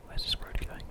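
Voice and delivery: usual speaking voice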